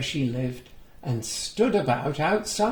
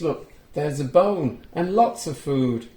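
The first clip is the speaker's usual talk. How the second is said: ordinary delivery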